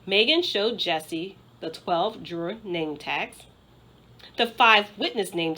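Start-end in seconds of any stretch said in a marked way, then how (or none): none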